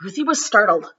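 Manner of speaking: lively, sing-song storybook read-aloud; reading to children. A narrator's reading. Teacher reads a picture book to her class, lively and sing-song. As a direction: read it like story time for kids.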